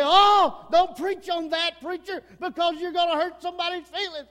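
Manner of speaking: mock the pew-sitter begging the preacher to go easy; mock churchgoer complaint. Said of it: "And we say".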